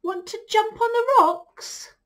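high, loud storybook goat; goat's voice. Acted. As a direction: call an invitation as a goat. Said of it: "said the goat".

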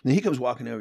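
ordinary talk

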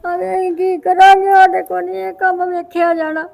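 loud tearful lament; crying. Acted. Distraught mother lamenting her son's affair, crying. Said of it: "ਰੋਵੋ ਨਾ ਤੁਸੀਂ".

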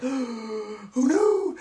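theatrical lament that climbs very high and drags; mock wail. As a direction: overplay the heartbroken mother. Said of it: "I was like".

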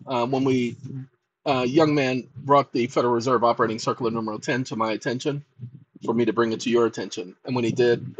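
deep late-night host voice; deep host persona. Acted. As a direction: apologize to the ladies and gentlemen in a deep, cool voice.

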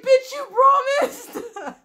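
high, drawn-out mock wail through laughter; mock accusation. Voicing people who accuse her of promising, high mock accusation. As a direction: imitate an outraged complaint, half laughing.

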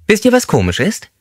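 high, thin, squeaky man's voice; squeaky-voiced inmate joking with the group. A narrator's reading. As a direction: pipe it up high like a small squeaky man. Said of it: "mit seiner piepsigen Stimme".

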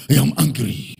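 angry God. Pretend. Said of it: "God is just standing and shouting".

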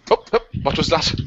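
ordinary talk, nothing marked

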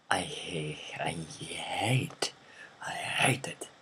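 whispered, drawn-out hate; intense whisper. Real, not acted. Vlogger introducing fifth pet peeve, intense whisper. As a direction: whisper it with slow emphasis.

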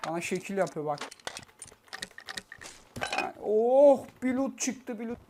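usual speaking voice

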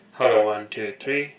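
loud mic check into the headset, hello one two three. Real, not acted. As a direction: call it out to test the mic. Testing the second button.

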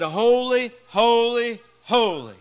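ringing proclamation, like angels calling to each other; angels crying out. Retold or imitated. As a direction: call it out word by word, easing on the last. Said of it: "the angels cried to one another".

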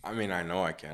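ordinary delivery

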